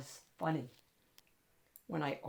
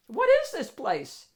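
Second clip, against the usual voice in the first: amazed question; 9 semitones higher, 9 dB louder than usual. Acted. Kid amazed inside dog club.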